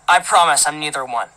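usual speaking voice

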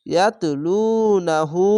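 ইয়াতলুনাহু slow, লু and হু held; teacher modelling the long u; stretched vowel. Shown as a demonstration. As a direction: draw out ইয়াতলুনাহু evenly. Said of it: "উল্টা পেশে এক আলিফ টেনে পড়বেন".

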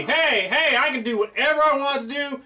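normal talking voice

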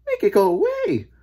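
high, loud plea; distressed lady. A narrator's reading. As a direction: beg in a very high lady's voice. Lady pleads with boy.

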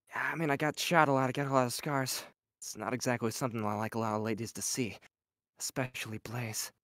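low, soft, brooding confession; Vash line. Pretend. Voice actor reading Vash's line to fans, low and soft. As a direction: murmur it like a scarred hero owning up. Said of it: "say that one line from ninety-eight".

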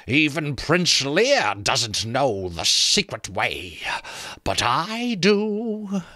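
high, slow sly boast; creaking skull voice. A narrator's reading. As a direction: creak out the offer like a teasing sentry. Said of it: "sounded like branches creaking".

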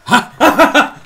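high, explosive "ha"; sudden big cackle; loud laugh. Real, not acted. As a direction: burst out laughing at a mishap. Laughs as both players die.

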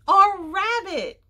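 high, loud, drawn-out exclamation; excited cry. A narrator's reading. Jessie and Henry realising what they heard, crying out. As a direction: cry out in sudden recognition. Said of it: "cried Jessie and Henry".

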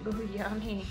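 normal talking voice

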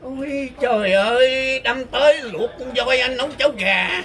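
ordinary talk

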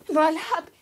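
high, loud desperate cry of his name; anguished loud call. Acted. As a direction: scream his name after him. Crying out after lost lover.

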